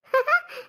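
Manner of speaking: brief high-pitched giggle; short laugh. Acted. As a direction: let out a quick, high little laugh. Shy laugh after admitting love match.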